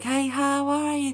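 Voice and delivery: high, slow wailing call; tuneless wail. Shown as a demonstration. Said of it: "it would sound like".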